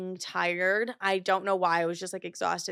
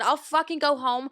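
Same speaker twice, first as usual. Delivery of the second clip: higher, louder defiant snap; retold angry snap; 5 semitones higher, 3 dB louder than usual. Snapping at sister who told her to go home, angry. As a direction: bite back defiantly. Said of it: "And I said".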